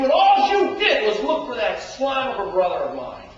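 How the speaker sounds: loud, high shouted grievance; older son yelling at his father; bitter rage. Acted. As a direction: let years of resentment explode. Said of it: "can you hear the bitterness in his voice".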